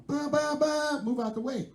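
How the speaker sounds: high, slow honking voice; mock horn honk. Girlfriend honking at guys in front, mock horn. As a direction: imitate the car horn, then bark the order.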